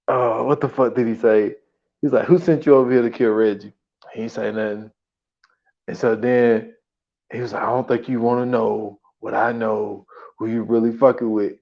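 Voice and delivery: lightly imitating a low menacing ask; a hint of a low gangster voice. Quoting [name] low.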